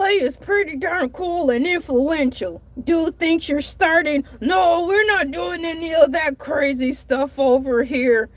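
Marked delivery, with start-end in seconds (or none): none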